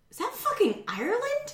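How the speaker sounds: high, stunned squeal of disbelief; shocked disbelief. Retold or imitated. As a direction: gasp it out like you can't believe your eyes. Shocked at a photo background.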